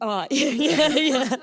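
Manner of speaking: real laugh bubbling through each word; embarrassed laughing. Real, not acted. Runner owning up to her nickname to the interviewer, embarrassed laughing.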